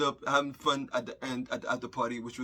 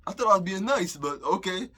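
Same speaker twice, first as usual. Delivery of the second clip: sarcastic retort; 5 semitones higher, 5 dB louder than usual. Retold or imitated. Hurt retort to the girl.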